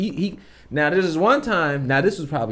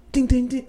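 doorbell imitation; 12 semitones higher, 3 dB louder than usual.

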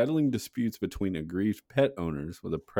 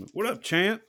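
eager fan greeting; 14 semitones higher than usual. Retold or imitated. Imagined greeting to Mike Tyson.

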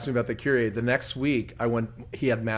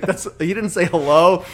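usual speaking voice

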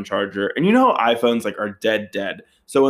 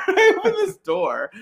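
laughing. Real, not acted. Repeats line, breaking into laughter.

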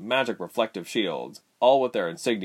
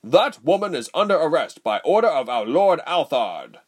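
loud, pompous soldier's shout; important shout; 7 semitones higher, 6 dB louder than usual. A narrator's reading. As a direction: bark out the order proudly. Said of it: "the lead soldier shouted importantly".

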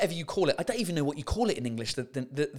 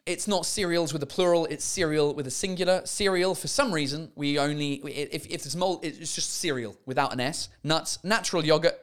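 ordinary talk

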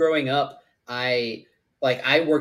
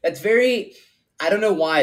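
excited; 6 semitones higher, 5 dB louder than usual. Real, not acted. Excited realization.

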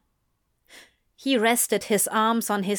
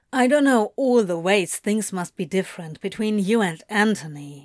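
plain reading voice

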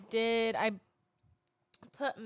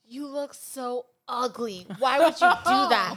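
yelling mom, imitated; 3 semitones higher, 7 dB louder than usual. Mom scolding child over missing eyebrows, mock yelling. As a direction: imitate an appalled mother, rising to a yell. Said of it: "she was all yelling at me".

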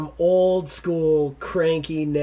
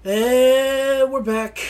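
slow, drawn-out, much higher and louder; announcer voice; 10 semitones higher, 7 dB louder, much slower than usual. Acted. Host announces the podcast's return, in a drawn-out announcer voice. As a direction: stretch it like a show announcer.

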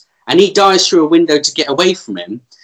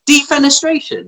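incredulous question; 9 semitones higher than usual. Real, not acted. Repeats new word, high.

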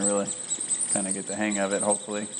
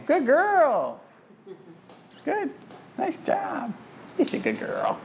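high praise for the dog; delighted praise voice; slower than usual. Real, not acted. Praising dog after fetch.

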